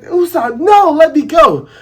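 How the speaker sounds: put-on high, pleading girl voice; high panicked plea; frightened Kaya. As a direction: struggle free in a panic. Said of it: "And Kaya's like".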